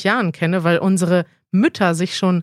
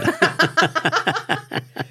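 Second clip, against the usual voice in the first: high startled giggle; laugh, caught off guard; surprised laugh; 10 semitones higher than usual. Real, not acted. (überraschtes Lachen)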